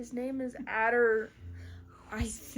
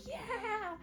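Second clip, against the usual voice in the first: high, soft little cheer; imitating happy excitement; mock delight; 9 semitones higher, 7 dB quieter than usual. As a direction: answer with a small high cheer. Injured cat delighted to swim.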